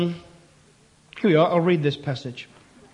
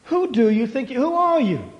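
higher, pushing, incredulous; indignant challenge; 5 semitones higher than usual. Shown as a demonstration. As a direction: demand it like an affronted official. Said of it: "That's really what their language was".

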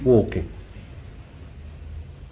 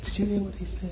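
high, hushed aside in disbelief; disciples' shocked aside; 9 semitones higher, 9 dB quieter, faster than usual. Acted. Disciples aside among themselves after hearing Jesus, hushed disbelief. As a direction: confide it softly, pitched well up, as if scandalised. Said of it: "they went aside and said".

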